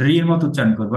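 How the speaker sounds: ordinary delivery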